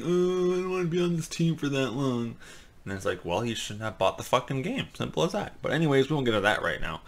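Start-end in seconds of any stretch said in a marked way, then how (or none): 0.0-2.3 mock whining complaint. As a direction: imitate a crybaby moaning about it. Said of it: "bunch of pussies were complaining about it, saying".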